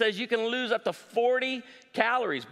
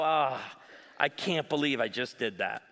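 muttered self-reproach, much lower; self-mocking; 7 semitones lower than usual. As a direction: shake your head at yourself, low. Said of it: "do something stupid and go".

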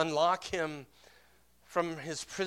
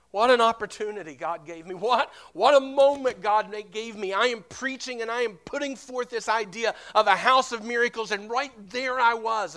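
higher, louder, emphatic preaching; exasperated self-reproach; 6 semitones higher, 8 dB louder than usual. Retold or imitated. Pastor scolding himself driving home over a missed moment, exasperated. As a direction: beat yourself up with impassioned force.

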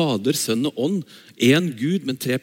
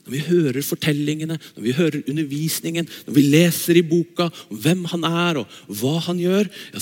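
ordinary delivery

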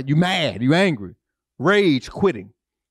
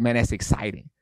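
low, understated gangster-style pastor; lightly imitated tough guy, pitched down; Wade Bryant; 7 semitones lower than usual. Pastor friend on the anniversary, low gangster-like voice. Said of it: "he talk like a gangster".

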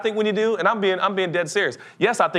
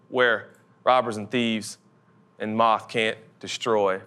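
scripture reading; 4 semitones lower than usual. Acted. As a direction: read the verse reverently, letting it settle. Reads scripture.

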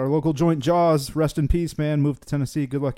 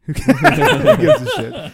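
loud, slow laughing yell; laughing shout; 11 dB louder, much slower than usual. Real, not acted. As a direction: blurt it out through laughter. Laughing outburst.

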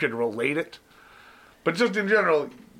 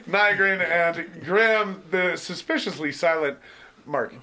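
podcast host intro; 4 semitones higher, slower than usual. Acted. Host introducing his co-hosts, in a hammed-up announcer voice. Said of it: "Great little intro today".

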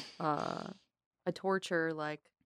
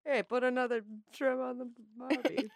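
mock Australian accent; 7 semitones higher, 5 dB quieter than usual. Doing Australian accent bit.

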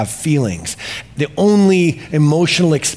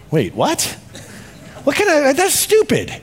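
jumps much higher in disbelief; incredulous scoff; 9 semitones higher than usual. Real, not acted. Preacher mocks the movie trope, incredulous and higher. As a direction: scoff at a ridiculous premise.

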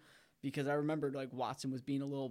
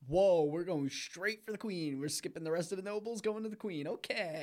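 higher and louder, wide-eyed disbelief; higher amazed exclamation at the twist; 5 semitones higher, 4 dB louder than usual. Retold or imitated. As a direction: react like the plot just shocked you. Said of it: "I was like".

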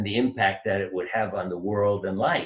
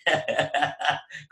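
incredulous laugh; 5 semitones higher, slower than usual. Retold or imitated. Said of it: "they'd look at me like".

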